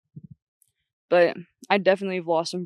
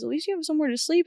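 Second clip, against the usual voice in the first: quick, higher, lightly voiced dad; imitating her dad; 8 semitones higher, faster than usual. Dad consoling daughter stuck in traffic, quick and higher. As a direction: rattle off dad's silver lining.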